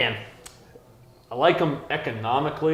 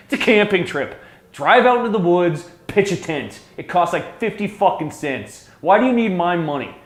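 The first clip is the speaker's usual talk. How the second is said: higher, louder exasperated yelling; angry rant; 7 semitones higher, 6 dB louder than usual. Real, not acted. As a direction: vent like your patience is gone. Ranting about donation requests.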